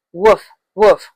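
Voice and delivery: "woof, woof" slow and separate, rounded W first; W sound as woof. Shown as a demonstration. Teacher says W sounds like this, W sound as woof. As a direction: sound out "woof" twice with a pause between. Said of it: "sounds like".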